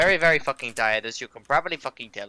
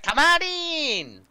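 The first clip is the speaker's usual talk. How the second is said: squeaky, very high, stretched slow; high drawn-out welcome; 13 semitones higher, 4 dB louder, much slower than usual. Retold or imitated. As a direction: sing out a long high welcome. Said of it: "standing there like".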